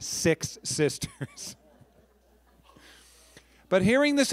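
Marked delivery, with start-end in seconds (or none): 0.0-1.6 "Six sisters" stretched out very slowly, each word weighted; "Six sisters" said slowly and deliberately; slow emphasis. Shown as a demonstration. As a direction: drag out "Six sisters" for a comic punch. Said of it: "I'm gonna say that again".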